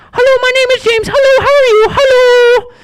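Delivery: squeaky falsetto, each line starting with hello; very high-pitched tone. Shown as a demonstration. Dad demonstrating how his son James will talk, very high-pitched. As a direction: squeak it out way up high like a silly kid. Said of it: "So he's gonna talk like this".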